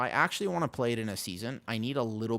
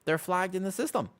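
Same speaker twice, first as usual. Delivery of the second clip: "They're flagged in the system" repeated much higher, indignant; "They're flagged in the system" echoed higher with comic indignation; higher mock indignation; 8 semitones higher than usual. Real, not acted. Repeats the line, second time raised.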